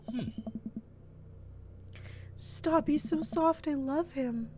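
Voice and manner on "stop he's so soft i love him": overwhelmed by cuteness. Real, not acted. Gushing softly over dog.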